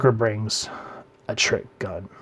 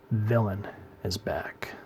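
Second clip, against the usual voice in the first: hushed, slow reveal of the villain; breathy teaser whisper; dramatic whisper; 2 semitones lower, 9 dB quieter, slower than usual. Real, not acted. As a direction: drop to a whisper for suspense. Teasing a returning villain.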